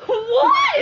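high, loud, half-laughing mock wail; mock crying disbelief. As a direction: ham up the kid's outrage.

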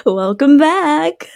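high, drawn-out singsong greeting; American podcast hosts' intro. Retold or imitated. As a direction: stretch each phrase upward like a gushing podcast host. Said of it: "this is exactly how they talk".

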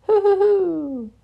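high, slow hooting laugh; high hooting giggle; Tigger hoo-hoo laugh. Acted. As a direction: whoop it out gleefully.

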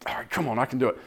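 nervous whispered courage; quiet self pep talk. Retold or imitated. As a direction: psych yourself up under your breath. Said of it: "self pep talk under breath".

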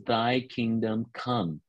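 slow, solemn; slow lead-in line. Shown as a demonstration. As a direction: set the words out slowly for others to repeat. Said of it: "Can you all say that with me?".

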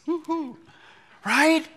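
high, loud whoop; mock cheer. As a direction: whoop like a fan. Celebrating the imagined miracle.

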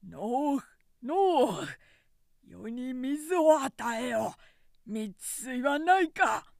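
slow, loud commanding cry for water; starving lord shouting to a farmer for honey water, loud and slow. A narrator's reading. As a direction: bellow at the farmer, high and drawn out. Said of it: "円術は大声で叫んだ".

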